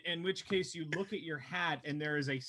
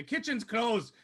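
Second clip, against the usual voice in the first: barmaid voice; 8 semitones higher, 4 dB louder, slower than usual. Acted.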